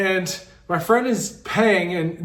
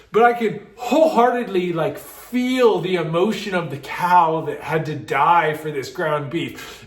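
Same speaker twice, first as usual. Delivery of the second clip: ordinary talk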